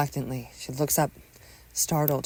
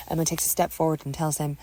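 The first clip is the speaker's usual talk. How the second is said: ordinary talk, nothing marked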